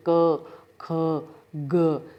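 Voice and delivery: क, ख, ग each separate with a drawn-out a; consonant with vowel. Shown as a demonstration. Teacher showing the inherent vowel, isolated क, ख, ग. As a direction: sound out क, ख, ग one by one, letting the अ ring. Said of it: "हर व्यंजन में अ की ध्वनि आती है".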